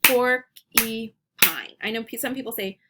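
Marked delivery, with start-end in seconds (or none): 0.0-1.1 PORK, EE, PINE, the first beat loud; clapped syllables. Shown as a demonstration. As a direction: break "Porcupine" into pork, ee, pine with gaps. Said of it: "We're gonna clap it".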